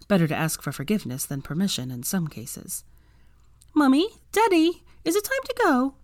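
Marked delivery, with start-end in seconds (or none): 3.7-5.9 put-on very high-pitched little child's voice. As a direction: call to mum and dad like an eager small child. Little Rose asks her parents.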